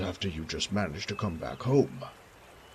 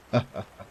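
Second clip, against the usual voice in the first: put-on short louder old man's laugh; a pleased little laugh; the baron charmed by his guest; hearty chuckle; 3 semitones higher, 5 dB louder than usual. Baron amused.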